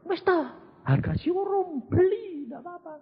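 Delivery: lightly voiced friend shrugging it off; voicing Pak Sam.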